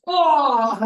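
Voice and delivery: high, very loud "oh" cry; loud exclamation. Real, not acted. Coach reacting as the group watches, exclaiming loudly. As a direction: burst out with a big whoop.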